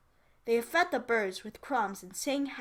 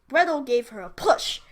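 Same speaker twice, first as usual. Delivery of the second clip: plain reading voice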